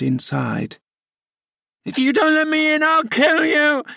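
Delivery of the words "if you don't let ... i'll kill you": frantic, much higher shriek; berserk rage. A narrator's reading. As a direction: shriek it in helpless fury. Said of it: "he rather shrieked than said".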